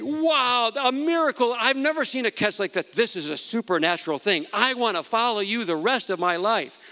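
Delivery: high-pitched, gushing imagined awe; hammed-up wonder at a miracle; acted amazement. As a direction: gush as an imagined eager new follower. Imagined awed reply to Jesus.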